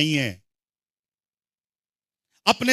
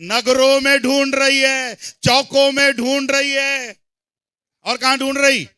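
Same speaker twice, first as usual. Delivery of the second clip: raised, insistent preacher's call; forceful preaching; 5 semitones higher, 5 dB louder than usual. Real, not acted. Preacher asks the congregation where the bride searches, forceful preaching. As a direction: drive each question and answer home.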